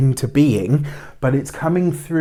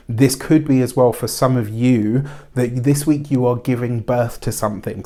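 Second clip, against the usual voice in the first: ordinary talk, nothing marked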